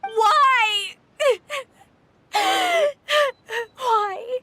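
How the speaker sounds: high, tearful cry of why; anguished sobbing. Acted. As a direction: cry out and break into sobs. Anguished at being condemned.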